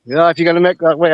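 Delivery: ordinary talk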